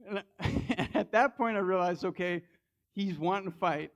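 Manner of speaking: chuckling through the telling; laughing. Real, not acted. Laughing while retelling the confrontation.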